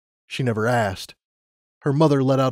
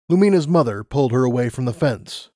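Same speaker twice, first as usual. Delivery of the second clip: ordinary delivery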